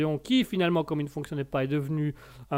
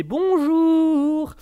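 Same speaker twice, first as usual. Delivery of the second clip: a much higher, put-on greeting voice; alter ego; 10 semitones higher, 3 dB louder than usual. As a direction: greet us as your other personality. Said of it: "Dédoublement de la personnalité". (un bonjour aigu de double personnalité)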